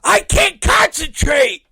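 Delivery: high, loud, slow mock shout; hammed-up angry boss yelling at him; slow furious mock holler; exasperated yell. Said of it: "And Schlack's like".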